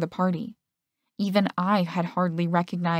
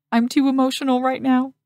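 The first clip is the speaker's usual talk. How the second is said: hammed-up, higher and louder fake weeping; mock tearful; 6 semitones higher, 5 dB louder than usual. Friend faking tears over the couple, mock tearful. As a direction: overdo the sob for a laugh. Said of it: "He dabbed at his pretend tears".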